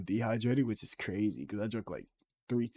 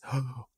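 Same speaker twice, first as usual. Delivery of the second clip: short, higher gasp; mock gasp; 4 semitones higher, 3 dB louder than usual. Streamer gushing to a chatter, mock gasp. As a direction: gasp as if amazed.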